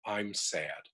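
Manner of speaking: a touch of sadness; sad. Shown as a demonstration. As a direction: say it softly, letting sadness in. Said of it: "I change the word to".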